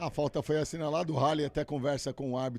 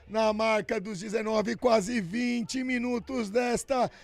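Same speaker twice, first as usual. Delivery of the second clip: excited goal recap; 5 semitones higher, 5 dB louder, slower than usual. Real, not acted.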